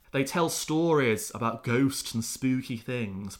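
ordinary delivery

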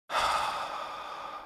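long, breathy sigh of defeat; deflated sigh after yet another rejection; heavy sigh. Real, not acted. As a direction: let all the air out, worn down. Weary after reading rejection.

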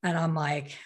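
ordinary talk

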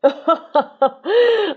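loud, high laugh; burst of laughter. Real, not acted. Laughing at her own news-anchor bit. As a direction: crack up at the joke.

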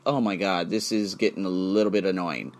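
low, soft fed-up grumble; weary annoyed. Retold or imitated. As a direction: sigh it out, tired of the trolls. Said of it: "And I'm like".

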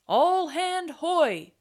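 long, high, slow holler; shouted pirate call. A narrator's reading. Another crewman summoning all hands, hollering. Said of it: "Another voice".

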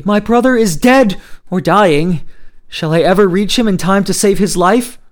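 loud, high-pitched anguish; bitter cry. Acted. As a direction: cry out in grief for a lost brother. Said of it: "he cried bitterly".

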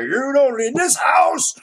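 high, slow, strict-dad voice; militant dad. Pretend. Strict militant dad laying down rules to his kids. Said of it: "if you're a militant dad".

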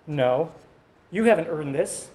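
normal talking voice

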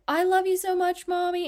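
put-on a kid telling mommy I love you; child's voice. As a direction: gush it like a small child hugging mommy. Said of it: "your child is like".

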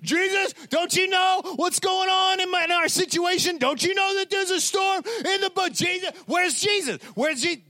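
hammed-up high-pitched panic; frantic mock shrieking, as if about to drown; freaking out. Disciples calling for Jesus in the storm, acted out in panic. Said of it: "the disciples start freaking out".

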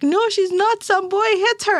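insist, upset, way up in pitch; retold insistence. Frantic mother insists to teacher.

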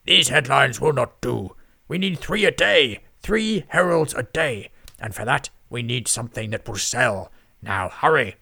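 loud, booming pompous lord; barking editor. A narrator's reading. Lord Lloyd barks orders at his scribes, loud and booming. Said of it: "bark more orders".

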